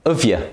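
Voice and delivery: "uvje" said as one weak, run-together syllable; "have you" reduced to "uvje"; weak form joined. Shown as a demonstration. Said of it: "You say".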